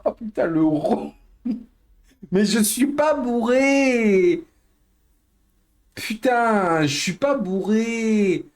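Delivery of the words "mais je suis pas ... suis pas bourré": put-on town drunk. Denies being drunk, drawn out.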